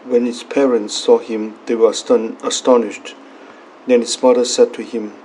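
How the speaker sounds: steady, solemn proclamation of scripture; Gospel reading. Acted. Priest reading the Gospel at Mass, solemn and measured. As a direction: read the Gospel aloud to the congregation.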